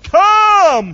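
high, loud call across the water; a ringing high summons. Acted. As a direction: call him out of the boat with full voice. Jesus calling Peter out.

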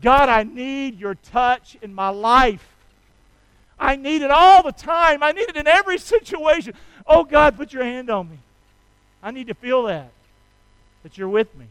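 loud, earnest pleading; praying aloud for protection; fervent prayer. Acted. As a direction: ask God for help with force. Voiced prayer.

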